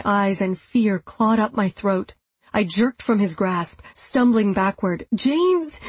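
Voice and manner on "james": mild read scream. A narrator's reading. Amy calling James for help, high mock scream. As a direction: call for help high but held back. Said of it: "I screamed".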